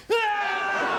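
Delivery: sudden high shriek of fright; startled scream. Acted. Timothy finds a stranger on his bed, startled scream. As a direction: yelp in shock at the stranger.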